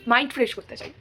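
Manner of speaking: ordinary delivery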